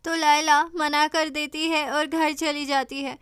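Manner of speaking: ordinary delivery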